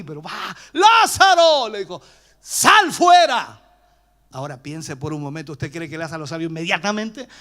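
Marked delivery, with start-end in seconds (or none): 0.7-3.6 grito agudo, «sal fuera» muy lento; llamado fuerte. Retold or imitated. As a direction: ordena con autoridad. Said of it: "alza su voz". (Jesús ordena gritando que salga)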